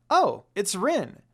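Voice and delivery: loud, bright happy exclamation; light up at the sight of a friend; excited exclaim. A narrator's reading. Kagome spotting Rin, loud and excited. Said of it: "Kagome exclaimed excitedly".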